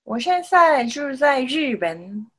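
ordinary delivery

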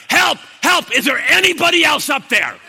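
very high, loud yell; crying out for help. Retold or imitated. Jack appealing past God for rescue, yelling. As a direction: yell for anyone else up there. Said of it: "Jack cries out".

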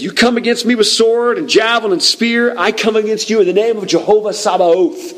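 high-pitched battle cry, driven hard; defiant yell. Acted. David yells at Goliath in God's name, defiant yell. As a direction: yell it out at the enemy in one charge. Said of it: "He screams out, I mean just yells at him".

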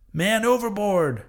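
high, urgent shout of man overboard; cry of alarm. A narrator's reading. Harpooner alerting the crew, urgent high shout. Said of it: "cried Daggoo".

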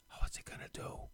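hushed whisper of an onlooker; acted, breath-only wondering; suspenseful whisper. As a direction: whisper it as if everyone is frozen. Acts out frozen crowd's suspense.

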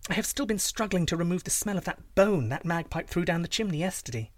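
high-pitched princess explaining away; fibbing princess. Acted.

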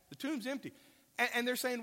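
usual speaking voice

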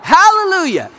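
high, loud churchgoer's hallelujah; excited congregation hallelujah; mock church shout. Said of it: "people are like".

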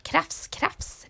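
soft slow scratchy krafs, krafs; whispered scraping sound; whispered scratching. A narrator's reading. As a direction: breathe it like claws on brick. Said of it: "lät det".

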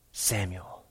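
very soft distant call of the name; hushed call. Acted. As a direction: call the name like a far whisper. God's voice calling in night.

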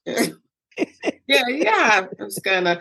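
put-on laughing evasion to her kids; evasive laughing. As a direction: brush them off while laughing. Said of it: "I'm like".

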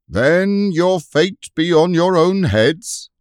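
loud, high and slow formal warning; a herald's solemn shouted threat; grim proclamation. Acted. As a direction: pronounce doom on them. Herald's grim reply across moat.

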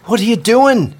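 loud, high, slow shocked yell. Acted. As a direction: shout down from the ferry in alarm. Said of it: "she yelled down".

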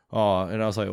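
normal talking voice

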